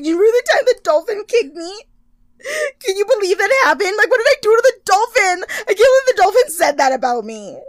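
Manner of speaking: milk it like a drama queen; mock whining. Imitating Laura milking her injury, high whiny and loud.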